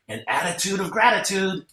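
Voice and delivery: put-on high, childlike little-girl voice; lightly voiced toddler whispering a secret; daughter's whisper. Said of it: "She whispered in my ear".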